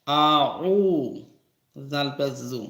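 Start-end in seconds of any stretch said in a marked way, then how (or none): 0.1-1.2 আউ with the উ held long; stretched long vowel. Shown as a demonstration. Teacher showing madd to learners, আউ with a long উ. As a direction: draw out the উ of আউ for one alif. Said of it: "এখানে একাল প্রাণ হয়".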